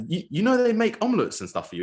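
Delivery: incredulous teammate. Retold or imitated. Presenter imitating a player stunned by his teammate's breakfast, high squeak. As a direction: squeak it in mock disbelief.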